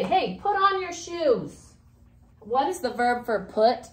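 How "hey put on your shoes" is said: higher, slow call to someone; acted command. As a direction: tell them to put their shoes on, pitched up. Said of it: "what if I wanna say".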